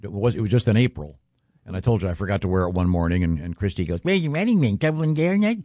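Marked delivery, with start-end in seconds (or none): 4.0-5.6 imitating his wife, pitched higher; mock wife voice, pitched up; wife's voice. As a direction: mimic his wife asking where the ring is. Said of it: "You have her voice down".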